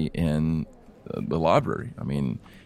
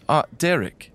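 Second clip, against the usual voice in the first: higher, bright greeting; librarian calling a colleague; 10 semitones higher than usual. Acted. Thea greets the old gnome librarian, higher. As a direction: hail the old gnome across the desk. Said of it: "and she says".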